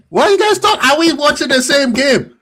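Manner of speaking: incredulous. Real, not acted. Heated football disagreement.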